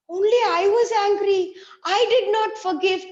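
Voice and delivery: high, loud dismay; regretful realisation. Acted. Izo realising he alone stayed angry, in loud dismay. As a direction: cry out in regret. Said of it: "Esau looked and said".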